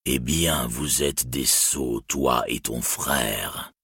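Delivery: low, slow, menacing dragon. Acted. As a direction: speak slowly like a mocking dragon. The dragon Glaurung speaks.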